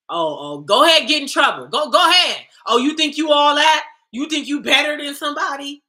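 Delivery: loud, high mocking taunts; mock scolding. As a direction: jeer like a scornful elder. Mocking voice that shames women.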